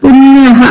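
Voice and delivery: పుణ్యః ending in a breathed ha; teacher stresses the ha of పుణ్యః; visarga as ha. Shown as a demonstration. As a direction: say పుణ్యః with a clear ha after the a. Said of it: "ఇక్కడ కూడా హా పలకాలి".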